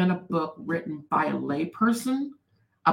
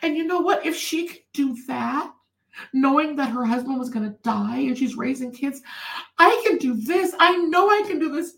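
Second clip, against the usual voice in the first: higher, rising determined woman's voice, imitated; resolved stranger; 8 semitones higher than usual. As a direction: voice her finding courage, pitched up. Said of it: "And she said".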